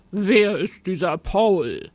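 gruff, demanding creature voice; growling monster. A narrator's reading. Monster questioning Rosa about Paul, growling. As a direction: interrogate her with a growl. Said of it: "knurrte das Monster".

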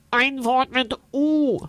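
high nasal voice, brisk; energetic nasal creature. A narrator's reading. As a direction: push him with a nasal voice. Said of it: "sagt Unzel Funzel energisch".

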